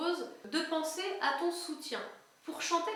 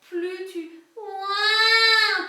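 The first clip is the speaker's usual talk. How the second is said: much higher, louder slow mock baby wail; crying-baby ouin; 6 semitones higher, 11 dB louder, much slower than usual. Shown as a demonstration. Coach imitates a baby's cry for high notes. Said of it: "comme un bébé qui pleure".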